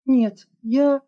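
high, loud flustered protest; a frightened teacher cut short; wheezed. A narrator's reading. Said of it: "прохрипела Алерона".

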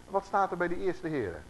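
usual speaking voice

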